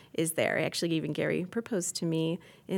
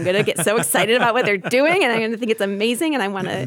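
excited; 3 semitones higher, 9 dB louder, faster than usual. Real, not acted. Gushing about new people's ministries.

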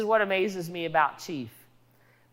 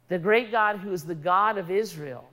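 ordinary delivery

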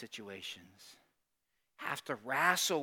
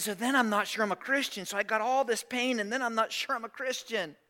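anxious doubt; 10 semitones higher, 5 dB louder than usual. Retold or imitated. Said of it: "He said".

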